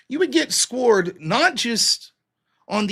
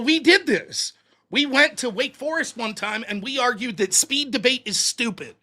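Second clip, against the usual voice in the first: ordinary delivery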